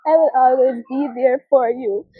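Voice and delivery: put-on high little-girl voice, then choked up; sweet child voice turning tearful; imitating her daughter.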